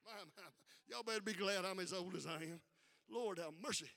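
normal talking voice

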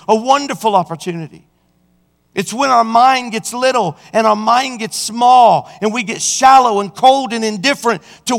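high, loud passionate preaching. Acted. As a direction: drive it home to the congregation. Preaching at rising force.

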